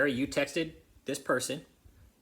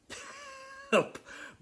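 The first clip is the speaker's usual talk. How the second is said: high scoffing laugh. Real, not acted. Scoffing at Harry's demand.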